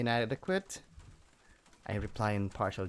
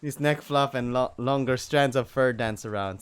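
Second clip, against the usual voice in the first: ordinary talk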